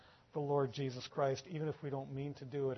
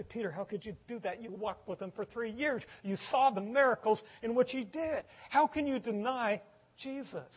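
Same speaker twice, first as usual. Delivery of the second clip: higher-pitched, mounting incredulous reproach; reproachful disbelief; 7 semitones higher, 5 dB louder than usual. Acted. We look back and question Peter's denial, incredulous. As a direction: plead and scold, building louder toward the end.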